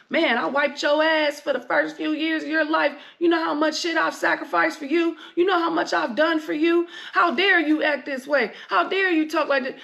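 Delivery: hammed-up parent; exasperated parent. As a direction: play the parent scolding an ungrateful child. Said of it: "I hate hearing shit like".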